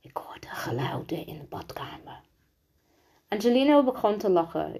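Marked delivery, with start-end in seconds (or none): 0.0-2.2 very soft, secretive whisper. A narrator's reading. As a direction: whisper it so nobody else hears. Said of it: "toen fluisterde ze". (zachtjes gefluisterd)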